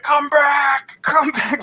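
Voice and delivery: high-pitched mock yell; imitated yelling. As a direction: call after them, hammed up. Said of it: "he's, like, yelling for them".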